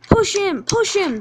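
high, loud, rapid chant; egging-on shout. Acted. Trucks urge each other to push Percy, loud and excited. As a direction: shout it as mischievous trucks urging a shove.

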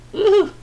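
loud, very high woohoo; excited whoop. Real, not acted. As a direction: whoop with delight at the haul. Excited about a great case.

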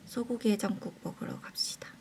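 whispering. Real, not acted. Whispered plan to eat.

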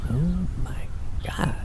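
awed whisper. Acted. Stunned at seeing the sister.

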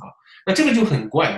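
ordinary delivery

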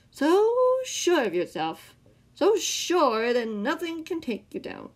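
slow, high, loud theatrical taunt; mocking. A narrator's reading. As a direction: draw the words out to belittle him. Villain mocking Sam's confidence.

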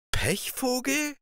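Pechvogel? slow, very high, drawn-out disbelief; incredulous princess. Acted. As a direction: echo the name as if it cannot be real. Princess repeats name.

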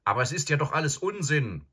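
put-on startled boy blurting back; dismayed. A narrator's reading. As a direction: defend yourself in alarm. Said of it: "sagte Edmund bestürzt".

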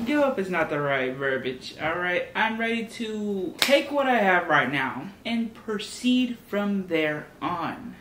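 ordinary talk, nothing marked

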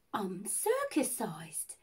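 low, soft and slow; nervous date confessing his secret, low and quiet; hushed confession. Retold or imitated. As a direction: lean in and confess it gravely. Date's serious confession.

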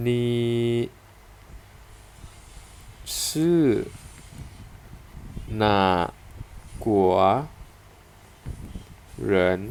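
นี่ชื่อหนากัวเหริน spelled out one syllable at a time with long gaps; teacher models each syllable of นี่ชื่อหนากัวเหริน slowly and separately. Shown as a demonstration. Teacher drilling students on the question นี่ชื่อหนากัวเหริน, syllable by syllable. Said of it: "นี ่ ชื่อ หนา กัว เหริน".

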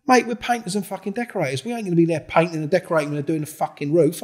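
high, loud, incredulous; retold indignant rant. Explaining to the accuser they are only decorators, indignant. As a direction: lay out how absurd the claim is. Heated retold pub confrontation.

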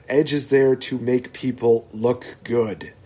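usual speaking voice

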